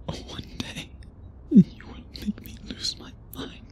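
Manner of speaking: breathy close whisper in the ear. Acted. Vampire whispering close to his beloved. Intimate words to lover.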